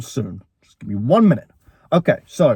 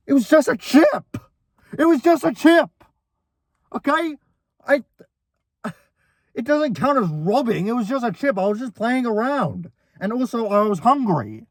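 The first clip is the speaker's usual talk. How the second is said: high, pleading it was just a chip; high pleading protest; 9 semitones higher than usual. Retold or imitated. To brother after the punch, a high whiny protest.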